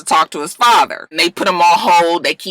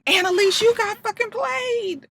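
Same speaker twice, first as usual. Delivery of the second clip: hushed scold; 12 semitones higher, 7 dB quieter than usual. Real, not acted. Scolding character.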